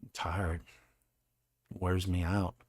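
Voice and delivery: soft, tired self-imitation; quietly pleading tiredness; worn-out self-quote. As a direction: imitate yourself running out of energy. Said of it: "I'd be like".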